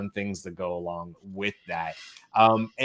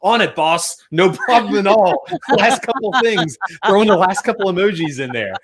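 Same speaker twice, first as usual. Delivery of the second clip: higher, louder eager-employee voice; eager hired helper; 9 semitones higher, 7 dB louder than usual. Retold or imitated. Hired helper answering the boss, eagerly, higher and louder. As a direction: chirp it like a keen hire. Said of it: "And they're like".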